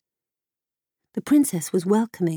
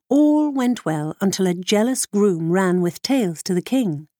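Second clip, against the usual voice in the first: usual speaking voice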